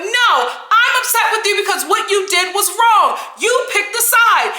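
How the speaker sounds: loud, high mock telling-off. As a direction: raise the voice and hammer the accusation. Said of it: "As soon as Kyle raised her voice".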